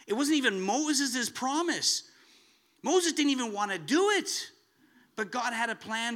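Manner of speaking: loud, high preaching burst; preaching at full force. Acted. Preacher stressing Moses' reluctance, loud and high. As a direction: lift it hard for the congregation.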